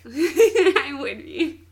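drawn-out admission through laughter; laughing. Real, not acted. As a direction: laugh loudly, then slowly own up. Laughing while admitting her interest.

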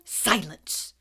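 a hint of a snarled command; mild snarl. A narrator's reading. Snape silencing Parvati, lightly snarled. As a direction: bite off the word. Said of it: "snarled Snape".

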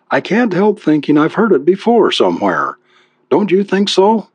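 high, gently hesitant wife voice; apologetic wife. A narrator's reading. Wife questions his last lines, apologetic. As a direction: tread carefully, lifting into a question. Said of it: "She smiled apologetically".

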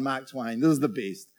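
ordinary talk

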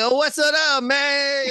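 high, hammed-up New York greeting; mock New York accent. Mocking the dad's part, hammed-up fake New York accent. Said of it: "faked his accent".

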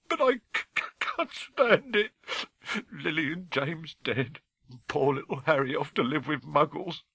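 high, stammering tearful wail; sobbing. Acted. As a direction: wail it brokenly through tears. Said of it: "sobbed Hagrid".

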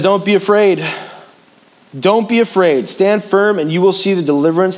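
ringing prophet's command; Moses proclaiming. Retold or imitated. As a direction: call it out boldly like Moses to his people. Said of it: "He says".

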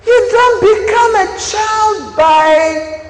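very high, slow and loud; slow, drawn-out preaching. Acted. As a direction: stretch each word as a sermon climax.

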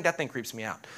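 ordinary talk

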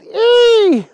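loud, very high drawn-out squeal; acted shriek at something gross; high yell of disgust. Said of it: "Agnes yelled".

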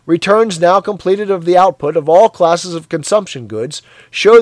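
loud, high propaganda broadcast; eager announcer. Acted. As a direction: sell the glorious news. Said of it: "cried an eager, youthful voice".

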